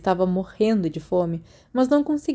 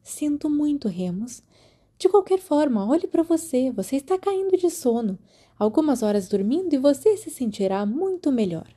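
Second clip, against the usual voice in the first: higher, brisk but kind nurse voice; a firm matron sending a tired boy to bed; caring school nurse; 7 semitones higher than usual. A narrator's reading. (enfermeira firme mas carinhosa, voz mais aguda)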